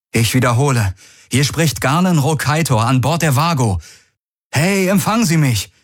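high, insistent radio call; projected hail turning pressing; urgent hail. A narrator's reading. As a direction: repeat the call, pitch climbing. Repeats hail to silent object.

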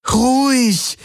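almost crying out to calm it; urgent pleading. A narrator's reading. Kirai to the rearing Schartak, desperate high plea. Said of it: "flehte Kirai ihn an".

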